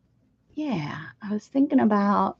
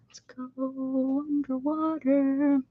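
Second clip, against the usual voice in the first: underwater voice; 4 semitones higher, 6 dB quieter, slower than usual. Pretend. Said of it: "I'm underwater".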